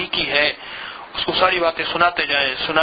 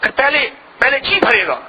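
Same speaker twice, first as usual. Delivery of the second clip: impassioned preaching; 6 semitones higher than usual. Acted. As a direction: build it up toward weeping. Building emotional exhortation. (جذباتی وعظ، بلند آواز میں)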